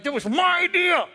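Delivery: high, squeaky mock protest; whining that it was your idea; indignant insistence. Imitating the prideful colleague in a meeting, mock whine. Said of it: "so you're like".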